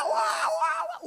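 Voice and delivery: very high, loud exasperated wail; frazzled shriek. Retold or imitated. Wife overwhelmed by cranky child, high shriek. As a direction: shriek in mock frustration.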